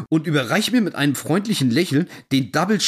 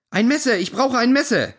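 mild shout for a knife; shouting over noise; 7 semitones higher than usual. Retold or imitated. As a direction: call it out over the din. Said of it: "Schreie ich gegen den Lärm an".

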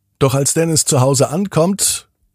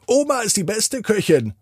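lightly voiced little boy, higher; a child's eager praise, pitched up; Dennis praising grandma; 10 semitones higher than usual. A narrator's reading. Boy Dennis praises Oma's cooking, higher child voice. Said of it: "sagt Dennis".

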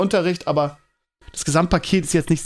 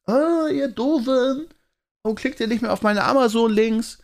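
high, slow, drawn-out put-on whine; hammed-up sing-song complaint; mock scolding; 6 semitones higher than usual. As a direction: tease the readers like a sulky child. Mock scolding his blog readers.

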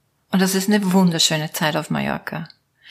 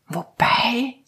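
"wobei" whispered higher with a rising doubtful tune; doubtful hesitation; 4 semitones higher than usual. Shown as a demonstration. Mimicking her own endless hesitation, whispered "wobei". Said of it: "also dieses Wobei".

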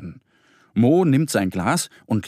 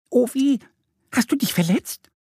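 high, concerned duck voice; little duck; 13 semitones higher than usual. A narrator's reading. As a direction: ask with worry, pitched way up. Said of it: "fragt Annie". (besorgte, hohe Entenstimme)

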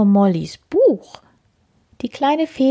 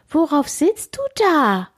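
startled question; 8 semitones higher than usual. Acted. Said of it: "fragt sie erschrocken".

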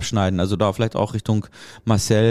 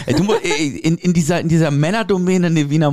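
laughing; 7 semitones higher, 4 dB louder than usual. Real, not acted. Laughing, teasing question.

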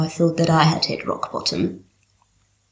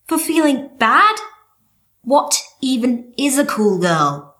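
escalating comedy rant; 2 semitones higher than usual. Acted. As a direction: build indignant fury line by line. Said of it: "the most fucked up part".

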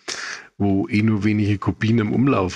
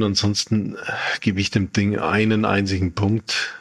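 ordinary talk, nothing marked

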